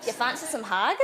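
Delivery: put-on playful Scottish accent offering food. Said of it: "Should we speak in Scottish".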